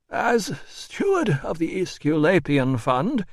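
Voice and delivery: high, slow, officious voice; pompous administrator. A narrator's reading. Administrator citing his office, high and pompous.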